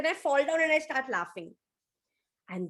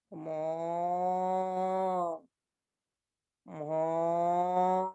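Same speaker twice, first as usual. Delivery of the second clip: low, long moo; cow moo; 6 semitones lower than usual. Acted. Cow arrives mooing.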